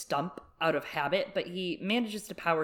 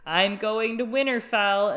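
higher, louder mock proclamation; mock loud announcement; 5 semitones higher, 5 dB louder than usual. Catelyn announcing her destination loudly, mock proclamation. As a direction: announce it for all to hear, hammed up. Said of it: "She said very loudly".

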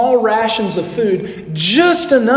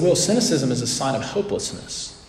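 a quieter, softer counselor asking; counselor's gentle probing; 8 dB quieter than usual. Retold or imitated. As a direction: gently press him where he lost hope. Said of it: "my counselor told me".

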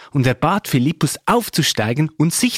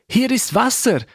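higher-pitched, lightly acted exclamation; ausgerufen; 10 semitones higher than usual. A narrator's reading. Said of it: "rief der Äthiopier". (hoher, leicht gespielter Ausruf)

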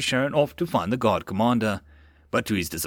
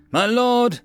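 soldier's address; 12 semitones higher, 6 dB louder than usual. A narrator's reading. Soldier reporting to lord.

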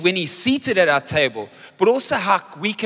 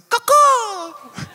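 high squawking eagle call; imitated eagle cry. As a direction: squawk like a diving fish eagle. Mimics fish eagle swooping.

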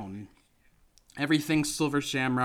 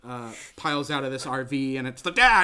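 ordinary talk, nothing marked

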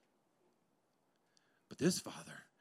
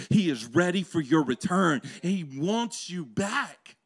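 loud, building preacher's cry; preaching at full force; 5 semitones higher, 10 dB louder than usual. Acted. Preacher urging every listener, loud and rising. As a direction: push it up to a shout.

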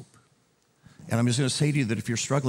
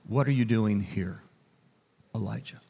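gentle voice. Acted. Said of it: "he came in a gentle voice".

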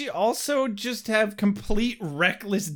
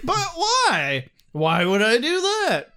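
high, singsong silly voice; mock Riley humming along; goofy Riley; 5 semitones higher, 3 dB louder than usual. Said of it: "Riley's literally like".

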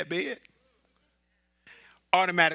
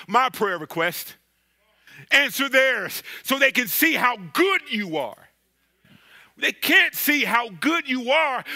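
louder, higher preaching cadence; full-force preaching; 7 semitones higher, 8 dB louder than usual. Real, not acted. Pastor presses the Sunday crowd about joy, fervent preaching. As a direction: drive it home from the pulpit.

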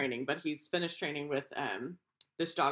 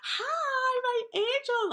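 put-on high squealed baby talk greeting; squealing; 4 dB louder, much slower than usual. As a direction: squeal it like greeting your puppy.

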